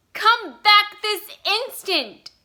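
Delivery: very high, loud and slow shriek; mother shrilling after her son; shrill command. A narrator's reading. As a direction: shriek it out, stretched and desperate. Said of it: "his mother shrilled".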